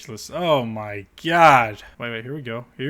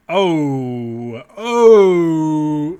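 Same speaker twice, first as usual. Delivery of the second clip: stunned louder groan at the photos; shocked oh; 3 semitones higher, 8 dB louder than usual. Real, not acted. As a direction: react to the wreck with disbelief. Reacting to wreck pictures.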